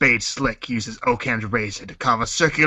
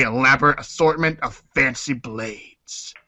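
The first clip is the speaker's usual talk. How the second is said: ordinary talk, nothing marked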